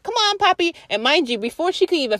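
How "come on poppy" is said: higher, coaxing voice calling the dog; lady's high voice. Retold or imitated. Lady in the rain calling her scared dog away, high and coaxing. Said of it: "So she's like".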